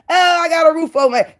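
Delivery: sneering high-pitched imitation; mocking. Mocking rival's bragging.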